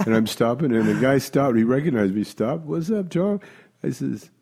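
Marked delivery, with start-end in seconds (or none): 2.7-3.4 friendly greeting. Retold or imitated. Friend greeting him from car.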